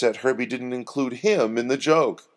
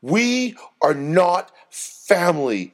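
slow, higher, weighty words; deliberate and intense; 6 semitones higher, 4 dB louder, slower than usual. A narrator's reading. Doreen rejecting Herbie's claim of kinship, deliberate and intense. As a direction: space each word out with force. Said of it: "Her words were deliberate and intense".

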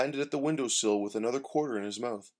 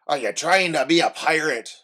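put-on louder old sailor, teasing; gruff old fisherman; 3 semitones higher, 8 dB louder than usual. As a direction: tease like a hearty old fisherman. Bob voiced, teasing Oscar.

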